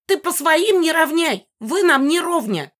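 high-pitched haughty fury; high, indignant screech; raging. A narrator's reading. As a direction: rage as a snobbish old ghost. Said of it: "лютовала Мегара".

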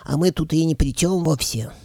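high dragon head. A narrator's reading. Middle head denying any blame, high-pitched. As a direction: plead innocence in a piping voice.

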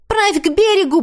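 high, loud urgent shout. A narrator's reading. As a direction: yell it across the water. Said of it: "прокричала я".

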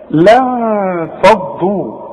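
لن فضوا slowly with ن hidden, no tongue contact; slow demo. Shown as a demonstration. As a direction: draw out لن فضوا, nasalised. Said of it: "ننطقها ازاي".

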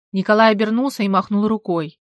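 usual speaking voice

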